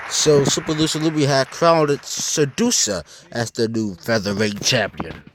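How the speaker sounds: announcer read. Acted. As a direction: announce the title change. Announcer reads title result.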